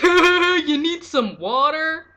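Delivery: high, loud, slow mocking taunt; smartass cop. Cops taunt Aquaman, loud slow mocking jab. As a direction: sneer it like a snide cop. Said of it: "the cops are, like, smartasses".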